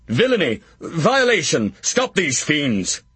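crying out for the guards; outraged shouting. Acted. Kent calling to the guards on the walls, slow outraged cry. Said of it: "shouted Kent".

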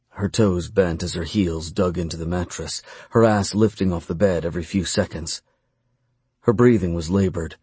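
ordinary delivery

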